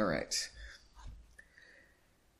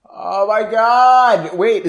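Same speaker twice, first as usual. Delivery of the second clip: loud, slow, drawn-out oh my god; genuinely startled exclamation; real shock breaking the reading; shocked outcry; 7 semitones higher, 10 dB louder, much slower than usual. Real, not acted. Reader reacts to a surprise.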